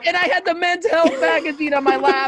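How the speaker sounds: high-pitched, loud comic confession; loud self-mockery. Real, not acted. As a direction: call it out over the laughter. Loud self-mocking confession over shared laughter.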